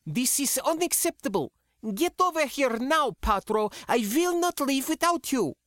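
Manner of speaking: high furious daughter ordering her father; barking, indignant. Acted. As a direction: demand he come now, refusing to go. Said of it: "Sindra barked".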